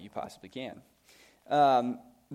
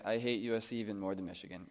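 ordinary delivery

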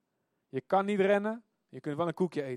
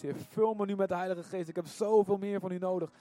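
rising, louder impassioned prayer, pleading higher and higher; preacher leading the church in a passionate prayer; fervent prayer; 4 semitones higher, 5 dB louder than usual. Acted. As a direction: pour it out to God, building to a cry.

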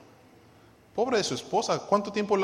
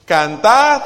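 loud, rising call to read aloud; leading group reading; 4 semitones higher, 12 dB louder than usual. Real, not acted. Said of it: "Leamos todos juntos".

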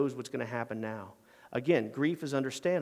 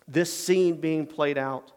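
normal talking voice

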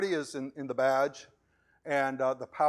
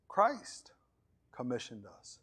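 ordinary talk

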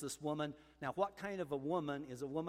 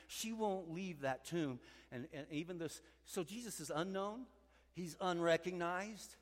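ordinary talk

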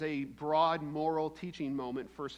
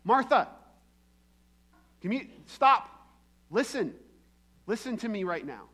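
higher, louder call to stop her; Jesus calls out to busy Martha, firm call; calling for attention; 5 semitones higher, 7 dB louder than usual. Retold or imitated. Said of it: "So Jesus has to say".

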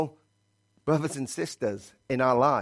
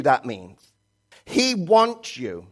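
fiery preaching; 5 semitones higher, 4 dB louder than usual. Acted. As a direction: preach it to the whole church, stretching key words. Preaching at full force.

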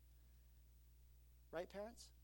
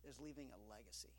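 soft punchline; 7 semitones lower, 13 dB quieter, faster than usual. Real, not acted. Sermon punchline.

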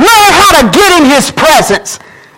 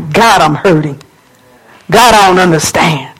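low, subdued plea to God; acted low-voiced, downcast prayer; hurting prayer; 8 semitones lower than usual. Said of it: "and say".

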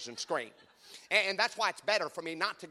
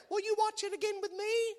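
much higher, pleading wife voice; husband mimicking wife asking for a rewatch; imitating wife. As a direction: coax him as the wife. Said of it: "She'll say, she'll say".

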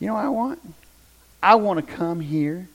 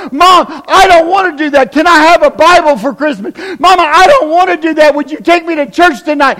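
put-on loud, higher kid's plea; pleading kids; 7 semitones higher, 10 dB louder than usual. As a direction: beg mom like an eager child. Children begging for church.

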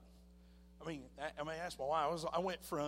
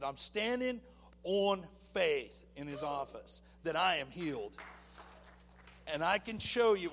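defiant declaration; 3 semitones higher, 8 dB louder than usual. Retold or imitated. Defiant reply to the doctor.

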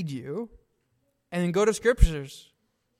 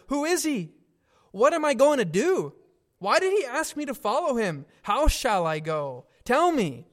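high, urgent questions of a frightened man; anxious pleading; 6 semitones higher, 4 dB louder than usual. Retold or imitated. Frightened refugee pleading with the pastor, high and urgent. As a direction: beg the pastor for answers.

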